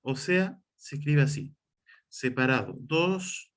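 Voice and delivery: ordinary talk, nothing marked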